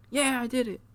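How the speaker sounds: high, hushed little cheer; small pretend victory yell, falling away; mock celebration. Valiant celebrates his title match, high soft mock cheer.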